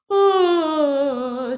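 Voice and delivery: open-throat voice. Shown as a demonstration. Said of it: "sounding like this".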